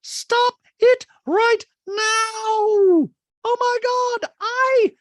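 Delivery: high loud slow caricature of a gushing rich woman; Dorit voice. Retold or imitated. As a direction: gush it in a high, loud mock-posh voice. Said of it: "Dorit with big eyes goes".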